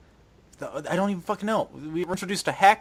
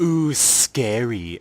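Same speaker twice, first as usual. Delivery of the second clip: slow smug mocking drawl; sneering, drawn-out "ooh, scary"; mocking blah blah; 3 semitones lower, 3 dB louder, much slower than usual.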